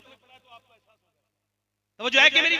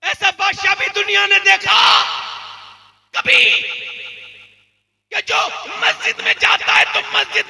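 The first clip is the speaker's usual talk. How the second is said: fiery preaching; 9 semitones higher, 6 dB louder than usual. Acted. Preacher rousing the congregation with a loud, high sermon cry. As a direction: thunder it out to the crowd.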